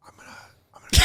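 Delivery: hushed whisper among sad players. Retold or imitated. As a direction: whisper so the losers can't hear. Katie whispering at the sad party.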